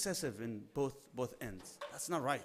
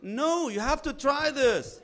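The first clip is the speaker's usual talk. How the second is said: louder, much higher, slow pushy insisting; insistent husband; 11 semitones higher, 9 dB louder, slower than usual. Retold or imitated. Husband pushing milk chocolate on his wife, loud and slow. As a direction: press her to taste it, loud and drawn out.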